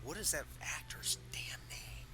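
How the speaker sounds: whispered under the breath; whispering. Real, not acted. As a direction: mutter it to yourself in a whisper. Muttering to himself, searching memory.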